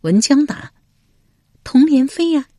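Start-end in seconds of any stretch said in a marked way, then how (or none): 1.6-2.5 high female character voice; high-pitched lady answering her husband; voiced noblewoman. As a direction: answer in a high, put-on lady's voice.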